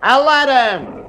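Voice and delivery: high, slow, desperate shouting at the machine; frantic demand. Acted. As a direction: plead and command at once, voice climbing. Husband demanding the room change.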